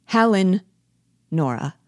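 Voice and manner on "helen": raised call toward the hall, louder. A narrator's reading. Husband calls the maid from the hall door, raised call. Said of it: "Goes to the hall door and calls".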